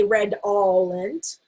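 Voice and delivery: redolent with a full stressed "all" in the middle, red-ALL-ent; slow redolent, middle syllable not reduced; unreduced middle vowel. Shown as a demonstration. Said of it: "We don't say".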